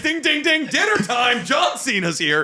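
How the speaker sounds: high, loud shouted talk-show hype; hammed-up host shouting about dinner bells; mock Jimmy Fallon hype. Mimicking Jimmy Fallon, loud and high.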